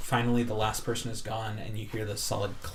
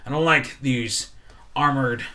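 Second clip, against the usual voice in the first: louder, building resentment; rising anger; 8 dB louder than usual. Acted. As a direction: let the anger swell. Bartender's angry rant.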